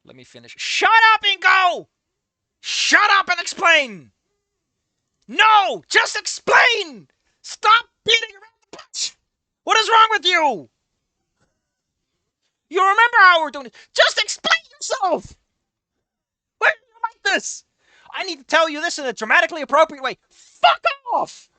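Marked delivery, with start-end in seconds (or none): 0.6-4.1 loud, fed-up scolding; frustrated yelling. Real, not acted. Yelling at the game characters. 5.3-17.6 higher and louder, demanding; shout, fed up with the stalling; exasperated shouting. Real, not acted. Yelling at the game characters. 20.3-21.5 high, shrill-pitched mock snap; squeaky pretend voice telling him off; mock retort.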